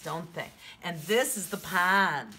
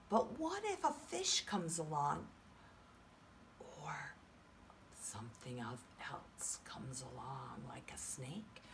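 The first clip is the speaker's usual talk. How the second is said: hushed suspense whisper; spooky whisper; 5 semitones lower, 13 dB quieter than usual. Shown as a demonstration. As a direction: whisper like danger is near. Suspense whisper about predator.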